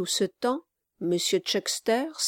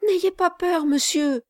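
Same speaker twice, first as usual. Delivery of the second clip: high, breathless reassurance; panting young girl; 9 semitones higher than usual. Acted. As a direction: still out of breath from running. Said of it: "la messagère encore haletante".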